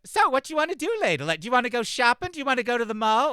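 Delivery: put-on loud, very high-pitched funny accent; [name]'s funny accent. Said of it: "It's such a funny accent".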